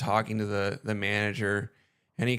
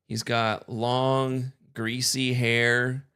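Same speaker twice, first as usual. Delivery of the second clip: slow description; much slower than usual. Retold or imitated. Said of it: "This is exactly what I said".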